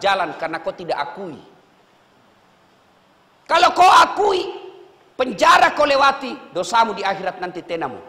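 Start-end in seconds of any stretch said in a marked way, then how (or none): 3.5-6.5 louder, higher preaching push. Real, not acted. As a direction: drive the point home to the inmates. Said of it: "preaching emphasis". (khotbah lantang)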